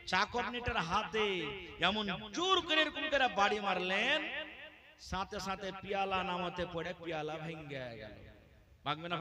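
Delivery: ordinary talk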